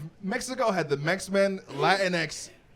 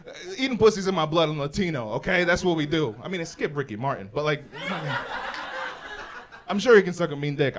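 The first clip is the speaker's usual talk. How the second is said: usual speaking voice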